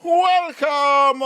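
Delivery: ordinary talk, nothing marked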